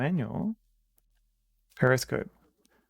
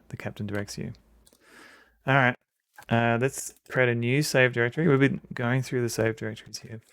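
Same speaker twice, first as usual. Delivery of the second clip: normal talking voice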